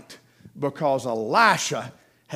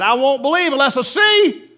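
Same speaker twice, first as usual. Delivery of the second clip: defiant Thomas; 8 semitones higher, 7 dB louder than usual. Acted. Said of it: "He said".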